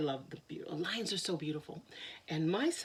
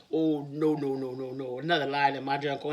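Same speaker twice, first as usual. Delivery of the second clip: low lion voice; 6 semitones lower, 4 dB louder than usual. Acted.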